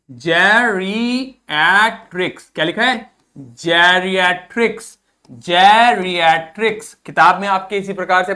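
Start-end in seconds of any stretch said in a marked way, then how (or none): 0.1-2.5 जेरिएट्रिक्स stretched out syllable by syllable, very slow; slow model pronunciation. Shown as a demonstration. Teacher reads the new word slowly for students to copy. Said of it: "इसका pronunciation पढ़िए". 3.4-6.9 जेरिएट्रिक्स said slowly with a rising end, twice; slow repeated pronunciation. Shown as a demonstration. Teacher drills the word slowly for the class. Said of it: "बोलिए इसको जेरिएट्रिक्स".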